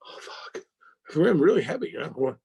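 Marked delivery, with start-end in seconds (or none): 0.1-0.6 shocked whisper. Retold or imitated. Hearing his character is killed.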